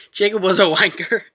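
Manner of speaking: ordinary delivery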